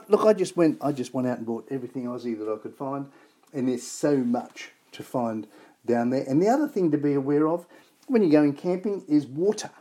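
ordinary talk

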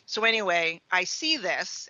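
normal talking voice

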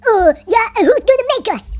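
loud, rough-crew challenge; hammed-up pirate defiance, pushed loud; taunting pirates. A narrator's reading. Pirates defy the heroes.

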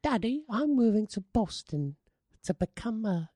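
high, put-on little British boy voice. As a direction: play a whiny little British boy telling Daddy. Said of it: "He's like".

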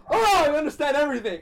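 overwhelmed awe, acted out; mock epiphany. Acting out his trip revelation.

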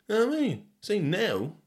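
usual speaking voice

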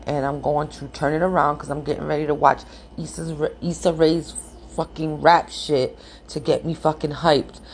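normal talking voice

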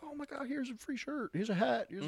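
high, far-off gushing voice of a thrilled shop owner; excited deli owner. Retold or imitated. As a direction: gush like a delighted host piling on gifts. Excited deli owner handing gifts.

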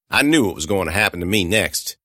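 ordinary delivery

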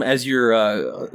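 ordinary delivery

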